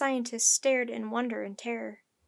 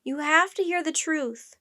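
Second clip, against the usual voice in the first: raised, insistent voice; loud yell; 5 semitones higher, 6 dB louder than usual. A narrator's reading. Jack urges the crew to listen, yelling. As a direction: call out urgently to a crowd. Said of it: "Jack yelled in his loudest voice".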